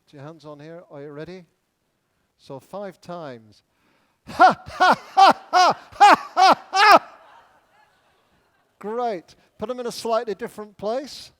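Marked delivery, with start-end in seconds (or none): 4.3-7.4 loud, forced "ha" bursts, evenly spaced; exercise laugh. Shown as a demonstration. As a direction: pump out deliberate belly laughs for an exercise. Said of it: "now we're going to laugh".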